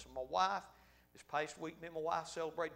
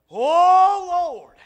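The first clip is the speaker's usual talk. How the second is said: much higher, much louder cry to God; fervent cry; 13 semitones higher, 23 dB louder than usual. Shown as a demonstration. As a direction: holler it out like at the altar. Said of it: "Slow down just a minute".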